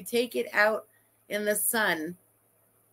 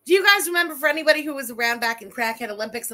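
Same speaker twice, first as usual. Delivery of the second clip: ordinary delivery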